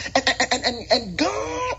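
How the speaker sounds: stammer, then swoop way up on "God"; stuttering preacher. Retold or imitated. Extrapolating the word of God, mock preacher stutter.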